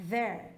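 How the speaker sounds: "there" with an English th, not a d; correct th sound. Shown as a demonstration. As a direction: say "there" with the tongue between the teeth, blowing air. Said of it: "se você falar corretamente".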